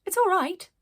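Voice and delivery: lightly voiced small girl. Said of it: "said a voice at her side".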